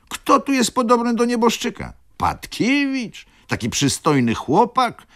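very high, loud incredulous protest; mock indignation. A narrator's reading. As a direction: protest in exaggerated disbelief, voice shooting up. Maleski mockingly defends his friend. (teatralne oburzenie, wysoko i głośno)

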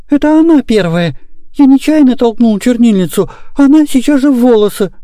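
put-on high, indignant child's voice; protesting boy. A narrator's reading. Boy blaming his sister to papa, high protest. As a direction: plead your case like a small boy.

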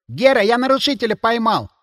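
put-on high, eager boyish report; boy calling out. A narrator's reading. Boy sentry reports a caught intruder to Гера, excited. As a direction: announce your catch in a high voice. Boy calls out to senior guard.

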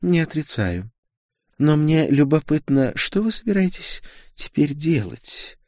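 high-pitched, politely curious woman; courteous sorceress. A narrator's reading. Sorceress asks the duke his plans, all courtesy. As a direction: keep it sweetly courteous, pitched well up. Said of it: "Она была сама любезность".

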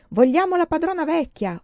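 grido alto e forte dei contadini; urlando. Acted. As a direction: urla la rivolta, voce molto più alta. (contadini che urlano sotto il balcone, grido forte)

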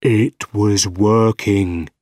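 slow, insistent inner voice; voice in the brain. A narrator's reading. Arms speaking inside scientist's brain, slow insistent voice. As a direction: press each word slowly into his mind. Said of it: "the voice in his brain got louder".

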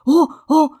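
loud, high exclamations of rapture; two bursts of joyful wonder; delighted cry. A narrator's reading. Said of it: "cria Daniel avec ravissement".

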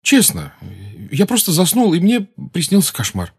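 lightly voiced teenage boy; a hint of a flustered boy; Harry's voice. A narrator's reading.

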